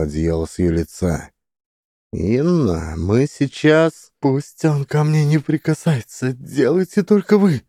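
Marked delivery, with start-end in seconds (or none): 2.1-4.1 high, slow bubbling voice; a hint of gurgling creature. A narrator's reading. Creature addressing Inna. 4.2-7.6 high, panicked plea. Acted. Heroine panicked refusal.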